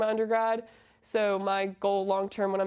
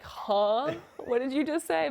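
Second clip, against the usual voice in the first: mock puzzled double take, higher voice; puzzled disbelief; 5 semitones higher, slower than usual. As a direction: imitate baffled teammates, pitch lifting after the "huh". Puzzled teammates reacting to her slang.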